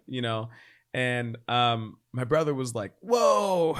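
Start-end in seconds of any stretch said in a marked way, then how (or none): 3.0-3.7 high, loud startled whoa; surprised whoa. Retold or imitated. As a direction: react like the news knocked you back. Brother's retold surprised reaction.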